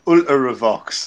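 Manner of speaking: Ultravox said slowly with an extra syllable, Ul-ter-ra-vox; Geordie Ultravox. Shown as a demonstration. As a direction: stretch Ultravox into four syllables, Geordie style. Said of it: "there's an extra syllable".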